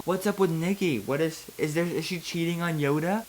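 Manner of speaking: ordinary talk, nothing marked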